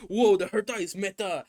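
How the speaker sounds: mock-thrilled crowd; mock hype. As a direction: shout out the fans' excitement, mocking it. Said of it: "everyone was like".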